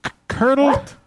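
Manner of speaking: shocked high-pitched echo; disbelieving squeak; incredulous. Real, not acted.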